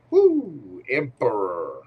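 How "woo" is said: excited woo. Real, not acted. Reacting to drawn card.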